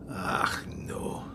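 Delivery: unvoiced, barely audible dread; shocked whisper. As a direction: whisper it as the fear hits. Rebus fears for his brother.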